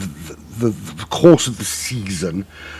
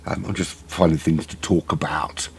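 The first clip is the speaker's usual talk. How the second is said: sheepish whisper; 5 semitones lower, 7 dB quieter than usual. Real, not acted. Aside about filling airtime.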